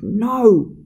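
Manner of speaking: high, loud shrieked no. A narrator's reading. Master refusing the servant's massage, shrieked no. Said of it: "He shrieked".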